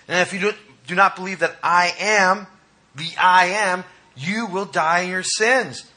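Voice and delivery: high, loud impassioned preaching; fiery sermon climax; preaching at full force. Acted. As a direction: drive it home to the congregation.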